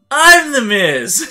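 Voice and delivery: loud, high, drawn-out imitation of a wrestler; mock-grandiose shout; self-important announcement. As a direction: blare it like a catchphrase, stretched out. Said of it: "He said it like that".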